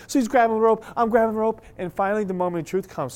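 normal talking voice

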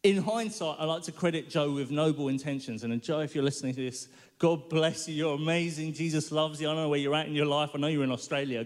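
ordinary talk, nothing marked

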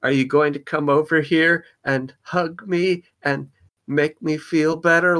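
pleading look voiced. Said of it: "he kind of looked at me and it was that".

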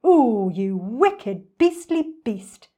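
slow, loud, swooping outburst; indignant storybook elephant; outraged cry. A narrator's reading. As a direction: cry it out, stretching each insult. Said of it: "cried Trunky".

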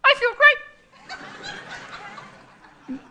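very high, perky talking-toy voice; high-pitched mock toy catchphrase; Sleep and Snore Ernie. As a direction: squeak it out like a talking doll. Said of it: "we're hearing".

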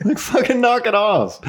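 high, laughing plea to stop; exasperated scolding. Retold or imitated. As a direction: scold them through laughter. Mock scolding German cars.

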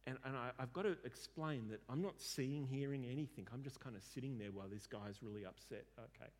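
ordinary talk, nothing marked

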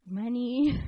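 tentative, drawn-out word with a rise; sheepish retold hedge; easing into it. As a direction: float it out gingerly, testing the reaction. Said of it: "I eased into it".

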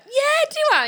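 high-pitched, loud proud reply; high squeaky surprise. Retold or imitated. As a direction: squeal it, pleased with yourself. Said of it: "I was like".

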